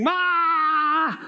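mock scream. Possessed man charges, screaming.

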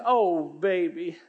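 lightly voiced older lady, slow and tender; a hint of a kindly older lady asking gently. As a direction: ease into a caring older woman's voice, drawn out. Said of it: "She says".

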